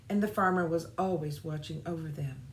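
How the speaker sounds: usual speaking voice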